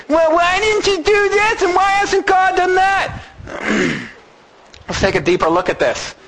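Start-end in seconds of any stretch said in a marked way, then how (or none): 0.1-3.2 high whiny complaining voice; whining. Pretend. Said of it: "It's the whining".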